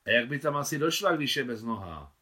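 put-on a boy stating the obvious; matter-of-fact boy. Boy explains granny can't walk.

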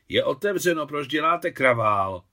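loud, annoyed scolding; exasperated outburst. A narrator's reading. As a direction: erupt at whoever is banging on the door. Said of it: "vybuchla Alexandra".

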